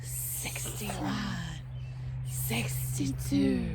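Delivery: slow hissing whisper with long S's; snake talk. Shown as a demonstration. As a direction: hiss the numbers like a sunning snake. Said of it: "we're gonna talk snake talk".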